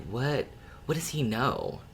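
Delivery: ordinary talk, nothing marked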